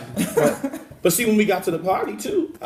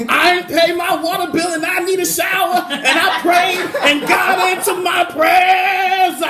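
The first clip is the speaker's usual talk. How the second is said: high, slow, half-sung hallelujah shouts; mock sermon at full cry; hammed-up preacher shouting; 11 semitones higher, 4 dB louder, slower than usual. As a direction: testify like a revival preacher, stretching the words. Hammed-up church testimony about rain.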